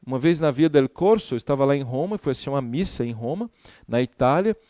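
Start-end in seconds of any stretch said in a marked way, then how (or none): none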